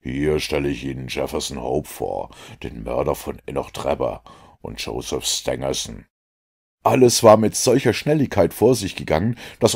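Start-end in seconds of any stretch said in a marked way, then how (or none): 0.0-6.0 a hint of Holmes's triumph; triumphant Holmes. A narrator's reading. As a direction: unveil the murderer with quiet triumph. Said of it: "rief er mit blitzenden Augen".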